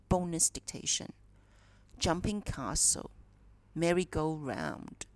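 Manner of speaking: ordinary talk, nothing marked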